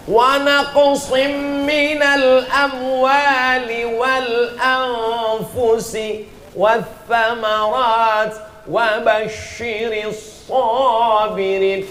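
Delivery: slow, melodic Arabic recitation; fluent Quran recitation. Shown as a demonstration. Preacher shows correct fluent Arabic to the congregation, slow. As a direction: recite each letter with care.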